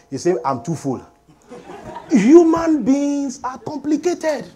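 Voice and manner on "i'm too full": sad person. Acted. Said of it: "he's sad again".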